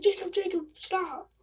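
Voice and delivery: high, urgent call of a name; alarmed nurse calling out. Retold or imitated. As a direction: call him to stop, alarmed. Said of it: "She was like".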